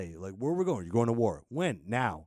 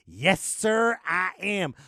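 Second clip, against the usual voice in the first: loud, higher cheerful yes sir; loud and higher, drunkenly cheerful to the officer; drunk proud admission; 8 semitones higher, 8 dB louder, much slower than usual. Retold or imitated.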